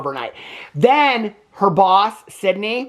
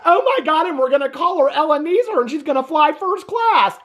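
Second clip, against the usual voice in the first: high-pitched gushy pitch, lightly mocking; mock gushing excitement; 7 semitones higher than usual. Mimicking filmmakers thrilled about casting Ebenezer, mock gushing. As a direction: imitate someone thrilled with their own idea.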